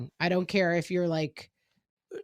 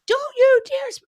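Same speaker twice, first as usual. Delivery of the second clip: sharp, much higher and louder cutoff scold; angry mom; 10 dB louder than usual. Retold or imitated. Mom cutting off her son's back talk, sharp and loud. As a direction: snap like a mom losing it. Mom losing temper at son.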